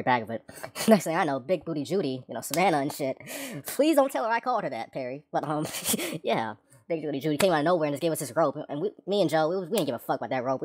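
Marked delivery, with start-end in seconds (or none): none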